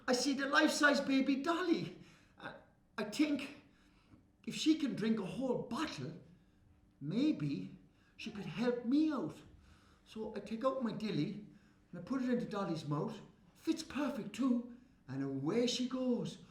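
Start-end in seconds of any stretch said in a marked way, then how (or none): none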